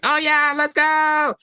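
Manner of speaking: very high mock cheer; excited little kid. Kid smashing the keys in Mario, wildly excited. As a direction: squeal it like a kid on a winning streak. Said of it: "and you're like".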